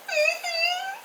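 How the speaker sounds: too overwhelmed to speak; tearful mm-hmm. Retold or imitated. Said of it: "And I'm just like".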